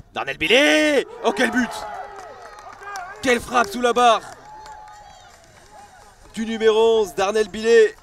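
shouting in awe, high and loud; excited sports commentary. Real, not acted. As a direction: roar at a stunning strike. Stunning goal, commentator shouts.

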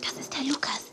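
soft unvoiced whisper; hushed whisper in the studio. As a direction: whisper so the studio can't hear. Quiet in TV studio.

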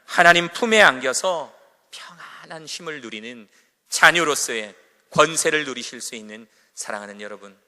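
loud, earnest closing blessing; preaching benediction. Acted. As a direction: build to a fervent blessing.

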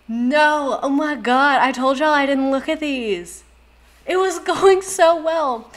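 high, loud lament; wailing in disappointment; dramatic dismay. Real, not acted. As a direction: bemoan a ruined dream. Dismayed at spotting supporters' flag.

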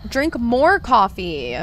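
slow, drawn-out command; emphatic, elongated nudge; playful urging. Pretend. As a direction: stretch each word to urge them on. Urging sleepy viewer to drink coffee.

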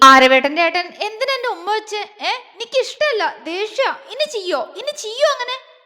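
high, sulky, indignant demand; angry, childish scolding. Acted. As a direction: scold him like a furious little girl. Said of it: "അവൾ ദേഷ്യത്തോടെ ചോദിച്ചുകൊണ്ട്".

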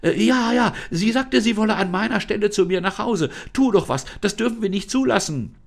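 put-on high, bright girl's voice, worried and pleading; girl Lisa, anxious. Trapped girl Lisa begs the unseen voice, high and worried.

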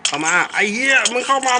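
high, loud taunting shout; angry yell at a mob. Real, not acted. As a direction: bring it on, yell at the monster. Yelling at a mob in game.

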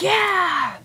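high, loud whoop; triumphant cheer. Retold or imitated. Viewer reacting to a TV arrest, triumphant cheer. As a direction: burst out cheering as at a win.

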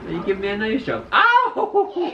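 fast, laughing protest. Real, not acted. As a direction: laugh while it still hurts. Laughing through the pain.

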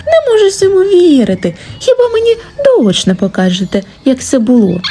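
high-pitched, insistent fox voice; stubborn fox. A narrator's reading. Fox demands to be shown what happened, stubbornly. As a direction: hold your ground as the doubting fox. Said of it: "уперлася при своїм лисичка".

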